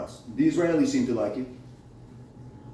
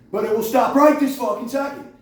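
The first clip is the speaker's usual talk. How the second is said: angry; 7 semitones higher, 4 dB louder than usual. Real, not acted. Ranting at whoever hacks his phone.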